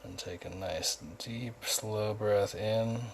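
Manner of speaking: soft, calm meditation guide voice; hushed, even guidance with unhurried gaps; guided meditation. Acted. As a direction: softly lead them inward, leaving long pauses. Leads guided chakra meditation.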